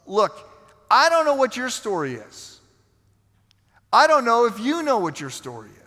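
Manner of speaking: high, insistent pulpit delivery; forceful preaching. Real, not acted. Preacher confronting listeners about their buried hurt, forceful.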